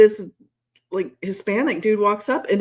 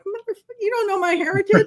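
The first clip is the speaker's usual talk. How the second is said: mock outrage; 13 semitones higher than usual. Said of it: "I was like".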